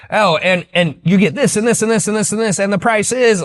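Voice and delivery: mock the pushy sales rep piling it on; hammed-up salesman. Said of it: "I hate when sales rep will say".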